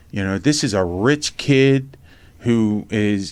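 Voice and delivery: normal talking voice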